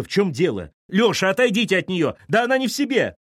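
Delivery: high, loud frantic cries; panicked shouting. Acted. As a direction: yell for help at a brawl. (панические крики)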